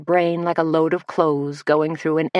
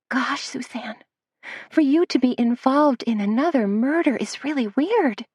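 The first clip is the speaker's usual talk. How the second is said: put-on higher, girlish voice of a young assistant; breathy young Missy; 8 semitones higher than usual. Missy to Suzanne in the cafe, higher girlish voice. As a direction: play Missy as sweet and a bit dazed.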